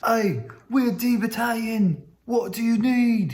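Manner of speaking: "we're D Battalion" in a high, slow mock British accent; second take, British soldiers greeting the runner in mock British accent. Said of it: "but in their British accents".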